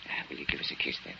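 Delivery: soft unvoiced whisper. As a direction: breathe the request like a secret. Suitor asks Maria for kiss.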